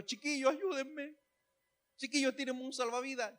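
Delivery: high, soft little cry for help; mock plea. Pedro asking the disciples for a lifebuoy, mock pleading.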